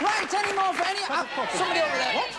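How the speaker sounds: high-pitched shout over the crowd; calling out. Real, not acted. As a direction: throw the question out to the whole room. Calling over crowd for more orders.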